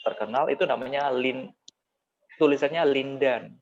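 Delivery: normal talking voice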